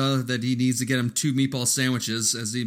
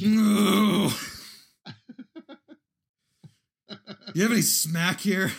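coked-up actor; 8 semitones higher, slower than usual. Retold or imitated. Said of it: "acting Sizemore".

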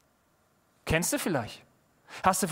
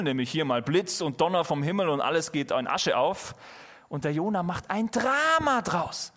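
ordinary talk

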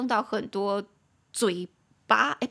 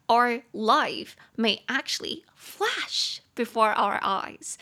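slow, carefully spaced flash before our eyes; slow model sentence; much slower than usual. Shown as a demonstration. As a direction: read it slowly for learners to copy. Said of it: "你就可以跟外国人说". (慢速示范英文句子)

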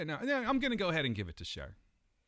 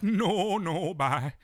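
dismissive, drawn-out mock-diva refusal; 4 semitones higher, 5 dB louder, much slower than usual. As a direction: wave them off in a put-on star voice. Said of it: "She'd be like".

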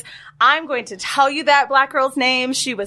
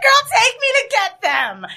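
much higher excited retort; eager mock excitement; 12 semitones higher than usual. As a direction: gush at the offer. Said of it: "I said".

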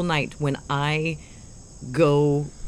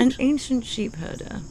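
higher British put-on; mock British accent; 7 semitones higher than usual. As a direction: finish the line in posh British. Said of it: "try an accent".

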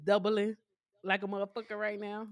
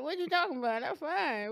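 mouth strapped shut; 4 semitones higher than usual. Retold or imitated. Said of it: "chin strap on, can't move mouth".

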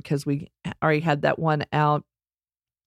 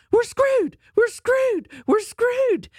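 mocking Chicken Little; slower than usual. Kids and wife poking fun at husband, high mocking chant. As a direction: tease him with his own panic. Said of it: "we would do the whole".

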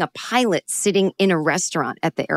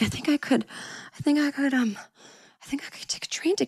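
higher and softer, sinking into a whisper; fading to whisper; 8 semitones higher, 7 dB quieter than usual. Retold or imitated. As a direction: talk yourself into it under your breath. Talking herself into it.

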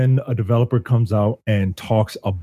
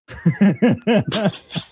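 laughter; 9 semitones higher than usual. Real, not acted. Laughs after his joke.

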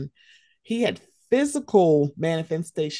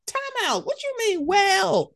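much higher, incredulous mock retort; outraged high retort to the excuse-makers; indignant comeback. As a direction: fire it back in disbelief. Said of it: "I'm like".

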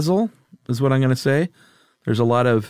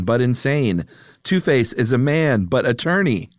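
ordinary talk, nothing marked

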